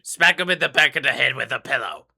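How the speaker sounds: fast, gleeful villain voice; fast sneering clown villain; Joker taunt. Acted. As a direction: taunt Batman like the Joker, quickly.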